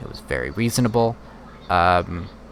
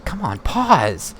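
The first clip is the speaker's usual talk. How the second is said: exasperated; 9 semitones higher, 4 dB louder, much slower than usual. Real, not acted. The clip plays on against his wishes.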